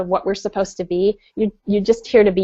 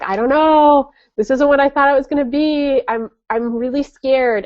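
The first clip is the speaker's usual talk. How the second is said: high, tearful plea of a scared woman; retold weeping; 5 semitones higher than usual. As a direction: sound close to tears, frightened. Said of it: "I just was weeping".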